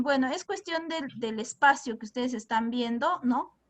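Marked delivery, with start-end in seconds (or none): none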